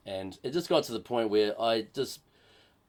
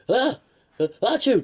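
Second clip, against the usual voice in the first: mock sneeze; 13 semitones higher, 9 dB louder than usual. Acting out feared reaction, sneeze.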